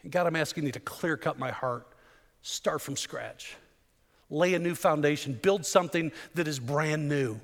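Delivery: soft, low, slowed prayer, nearly hushed on start from scratch; quiet prayer. Acted. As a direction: pray it gently, leaning into each request. Pastor praying aloud.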